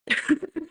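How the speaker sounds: a brief quiet chuckle; soft laugh. Real, not acted. Guest pleased with her devil twist, soft chuckle. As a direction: let a small laugh slip out.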